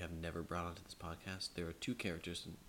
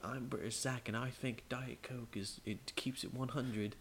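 slow, higher fake British accent; British Zach; 5 semitones higher, slower than usual. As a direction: drawl it in a deliberately bad British accent. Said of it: "do my British Zach accent".

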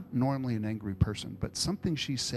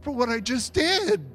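much higher and louder, astonished outburst; retold shock of discovery, loud and high; amazed exclamation; 11 dB louder than usual. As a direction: burst out in stunned wonder at the verse. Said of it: "I'm like".

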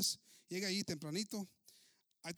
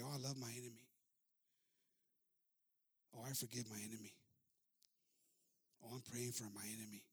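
smug soft piety; 7 semitones lower, 6 dB quieter than usual. Retold or imitated. Mock pious platitudes.

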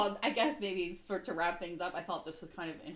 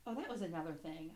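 ordinary talk, nothing marked

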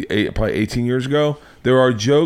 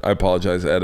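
normal talking voice

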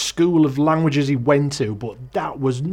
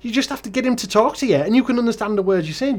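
high-pitched incredulous Geordie grumble; exasperated rant; 7 semitones higher than usual. Real, not acted. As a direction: protest in disbelief, pitched well up. Exasperated rant about Geordie translator.

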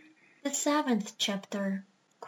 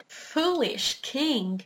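slow and stern; 3 semitones higher, much slower than usual. Acted. Parrot as doctor, slow.

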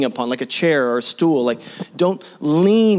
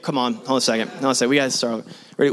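ordinary talk

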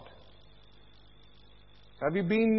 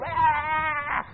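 mock tantrum cry; 9 semitones higher than usual. Baby imitating mommy's tantrum.

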